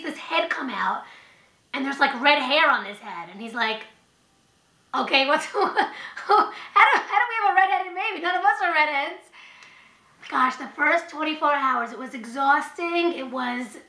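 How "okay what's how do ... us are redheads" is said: laughing disbelief. Retold or imitated. Husband's puzzled reaction with laugh.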